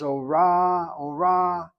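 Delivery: teacher echoes student's accented second syllable, ora with RA drawn out; stress on RA. Shown as a demonstration. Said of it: "she read it as".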